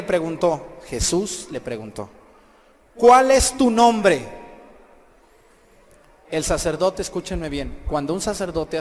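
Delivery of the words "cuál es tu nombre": very loud, slow, raised interrogation; commanding demand. Retold or imitated. Jesus questions the demon, loud slow command. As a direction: demand it with full authority, drawn out.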